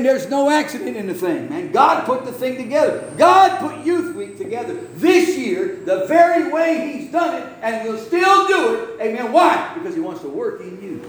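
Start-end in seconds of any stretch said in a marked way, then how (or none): 1.7-9.7 high, emphatic pulpit delivery; fervent preacher's cry; full-force preaching. Acted. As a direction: build it high, stretching the key words.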